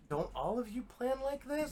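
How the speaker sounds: lightly voiced genuinely confused boy; innocent kid voice. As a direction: ask it like the obvious thing nobody gets. Damian confused, acted quote.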